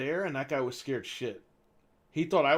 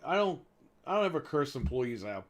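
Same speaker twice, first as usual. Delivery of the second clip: ordinary talk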